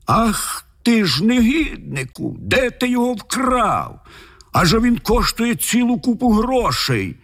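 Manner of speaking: high-pitched shrieked accusation, without much extra volume; shrieking. A narrator's reading. The lord accuses Michal of stealing the gem, shrieking. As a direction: screech it indignantly, way up high. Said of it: "той заверещав".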